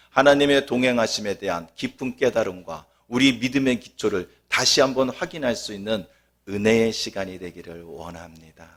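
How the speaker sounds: usual speaking voice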